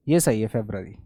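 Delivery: normal talking voice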